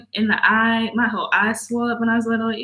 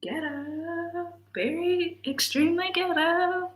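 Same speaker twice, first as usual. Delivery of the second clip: much softer, slow deadpan; disgusted aside; 6 semitones higher, 9 dB quieter, slower than usual. Real, not acted. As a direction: mutter it in disgust. Disgusted mutter.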